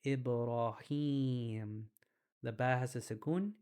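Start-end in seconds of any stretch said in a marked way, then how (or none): 0.0-1.9 Ibrahim said very slowly, each syllable clear; slow demonstration. Shown as a demonstration. Teacher modelling the word for students, very slow. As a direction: draw out Ibrahim with a clear ee sound at the start. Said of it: "turn it into an E".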